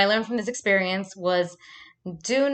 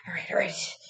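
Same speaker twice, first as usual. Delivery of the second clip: low-key whisper, retelling a thought; whispered self-talk; 3 semitones lower, 5 dB quieter than usual. Retold or imitated. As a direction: whisper to yourself as in the story. Retelling muttered thought before stabbing.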